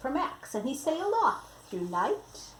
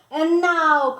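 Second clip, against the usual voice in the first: higher, louder proclamation; cry out; 6 semitones higher, 11 dB louder than usual. Acted. Max the king proclaiming to the monsters, loud cry. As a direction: announce it like a king. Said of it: "cried Max".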